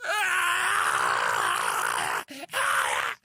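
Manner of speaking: low, loud guttural yell; furious man roaring at counselors. Retold or imitated. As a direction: bellow like a raging man. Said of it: "the guy's like".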